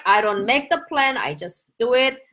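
usual speaking voice

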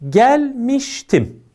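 "Gelmiştim" drawn out very slowly; teacher slowly spelling out the example word. Shown as a demonstration. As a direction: stretch "Gelmiştim" syllable by syllable. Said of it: "İkinci örneğe".

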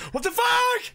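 real startled shout; shocked yell. Real, not acted. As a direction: burst out at the screen. Quiet then shouted repeat.

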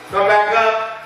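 ordinary talk

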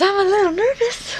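like cooing to a dog. Real, not acted. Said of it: "Ew, I sounded so weird when I said that".

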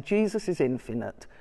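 normal talking voice

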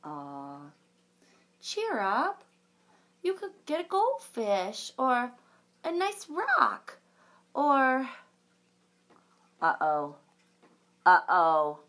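lively storybook read-aloud with big reactions; reading to children. A narrator's reading. Librarian reading a unicorn story to children, animated. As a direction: read it like a picture book for kids.

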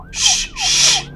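two long, sharp shushes; drawn-out "shh, shh"; shushing. Acted. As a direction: hush someone firmly. Character hushes the player.

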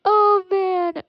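high mock wail; hammed-up sob over the mistake; mock crying. Gamer mourning his arrested friend, high mock wail.

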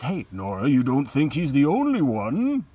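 lightly voiced Doctor Rank, hinting; voicing Doctor Rank. Teacher voicing Doctor Rank hinting to Nora, lightly voiced. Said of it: "Doctor Rank basically says".

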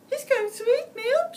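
high, loud, fast frightened squeal; terrified cry. A narrator's reading. Grandma squeals in terror at the wolf. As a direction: shriek it as she's about to be eaten. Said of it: "she cried".

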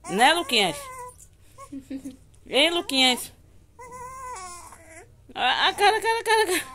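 high, loud cooing. Real, not acted. Mother playing with her baby: high loud cooing.

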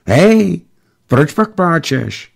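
put-on high-pitched boy's call, question drawn out; child calling out. A narrator's reading. Boy calls to the crying pilot from bushes, child calling out. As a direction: call over like a curious kid.

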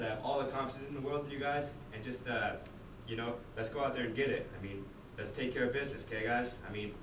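ordinary delivery

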